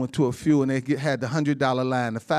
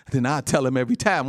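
normal talking voice

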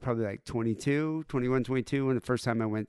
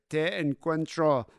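slow, higher Spanish with a Swedish lilt; lightly mocking Swedish guy; 4 semitones higher, slower than usual. Host voicing the Swede wooing the Spanish girl in slow, higher mock Swedish-accented Spanish. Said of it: "Swedish guy is like".

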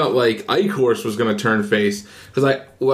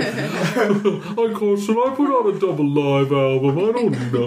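mock voice; 7 semitones higher than usual. Mock Master impression.

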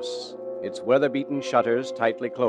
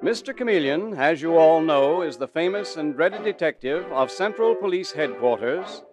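put-on booming old-time radio intro; old radio announcer; 6 semitones higher than usual. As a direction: announce it like a 1940s broadcast. Announcer opens the radio show.

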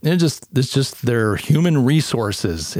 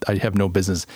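usual speaking voice

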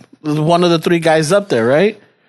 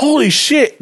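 shocked realization; 7 semitones higher, slower than usual. Retold or imitated. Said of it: "I'm all like".

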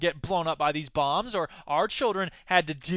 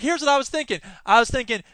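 ordinary talk, nothing marked

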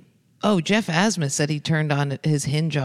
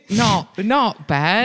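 slow, higher mock Australian; Australian accent; 5 semitones higher, 4 dB louder, much slower than usual. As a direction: drag it out like a cartoon Aussie. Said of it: "who's Australian".

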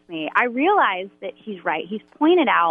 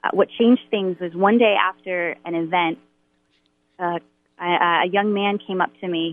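ordinary delivery

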